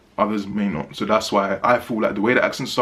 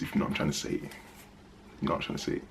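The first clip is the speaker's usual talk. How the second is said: near-whispered, knowing aside; hushed aside; 7 semitones lower, 13 dB quieter than usual. Real, not acted. Reviewer hinting that an actress is nice, hushed aside. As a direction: drop right down, almost to a whisper, as a sly nudge.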